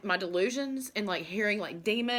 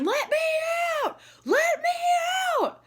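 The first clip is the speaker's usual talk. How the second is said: long, drawn-out shrieked cry, much higher and louder; trapped voice screaming; 6 dB louder, slower than usual. Retold or imitated. Imagined voice under the floor begging to be freed, shrieking. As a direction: wail it like someone trapped below the floorboards.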